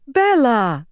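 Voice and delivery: high, loud cry of recognition; exclaiming. A narrator's reading. Swan sees the brown mare approach, exclaiming. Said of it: "Swan exclaimed".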